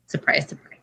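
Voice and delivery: soft sarcastic whisper; dry whispered aside that nobody's surprised. Real, not acted. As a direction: whisper it with an eye-roll. Sarcastic aside about Roman.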